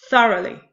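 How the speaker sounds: "Thoroughly" punched loud on THOR, falling away; stress on first syllable. Shown as a demonstration. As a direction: hit the first syllable of "Thoroughly", two weak ones after. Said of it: "The first syllable is stressed".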